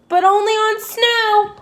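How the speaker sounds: high-pitched, urgent call; calling after someone. A narrator's reading. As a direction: call out to a customer already leaving. Shopkeeper calls after departing Dog.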